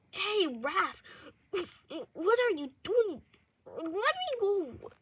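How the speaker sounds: high, loud plea to be released; acted distressed demand, pitched high; alarmed captive protest. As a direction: cry out as if being tied up. Captive protests being tied up.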